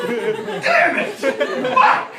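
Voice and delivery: very high comic cursing; loud, hammed-up exasperation; mock frustrated yell. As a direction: screech like a thwarted burglar. Thwarted robbers acted.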